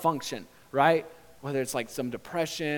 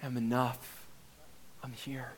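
confident, loving Father; 2 semitones lower, 9 dB quieter than usual. Acted. Father tells his child to wait, lovingly. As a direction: settle them gently, with long pauses. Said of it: "sure, confident, loving face of your Father".

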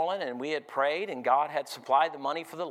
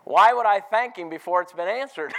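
louder, rising preacher's call to the church; full-force preaching; 4 semitones higher, 7 dB louder than usual. Acted. Preacher urging his congregation, loud and driving. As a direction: press the question home to the congregation.

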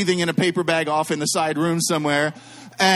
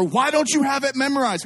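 retold outburst at a sister; mock yelling; 6 semitones higher than usual. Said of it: "I start yelling at her".

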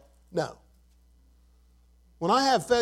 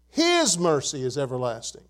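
His stretched long, the rest lower; stress on His; 5 semitones lower than usual. Shown as a demonstration. Preacher reading verse five to congregation, His emphasized. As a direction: open on a weighty, held His. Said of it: "I'm gonna emphasize the word his".

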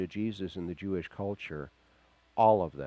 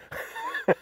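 squeaky gleeful laugh; a quick high-pitched chortle; high falsetto giggle. Acted. Mocking the note-taking Pharisees, squeaky giggle.

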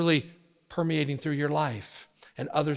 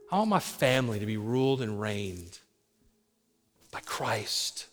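earnest prayer; 4 semitones lower, 5 dB quieter than usual. Shown as a demonstration. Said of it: "That's this kind of prayer".

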